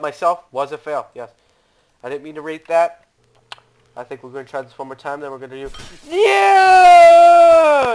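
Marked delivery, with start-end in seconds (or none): 6.1-7.9 long, high, very loud cry; screamed yell. Real, not acted. Gamer breaks off planning one more try, screaming. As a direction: stretch it into a full scream.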